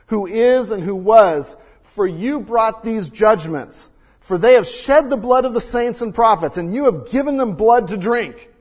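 scripture read with force. Acted. Pastor reading Revelation to the church, loud and measured. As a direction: let the angel's words ring out.

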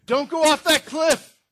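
shouted warning, way up high; loud warning shout. Shown as a demonstration. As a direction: yell like the kid is at the edge. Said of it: "sorry, too loud".

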